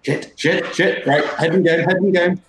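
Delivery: rattled, pulling yourself together; flustered self-talk. Acted. Silas refocuses after losing concentration, flustered. Fighter rattled, psyching himself up.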